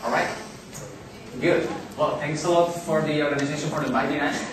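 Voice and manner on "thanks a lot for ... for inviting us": ordinary talk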